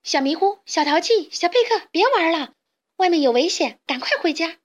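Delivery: put-on high, loud calling to children. A narrator's reading. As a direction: shout each name, urging them home. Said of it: "帮着呼喊".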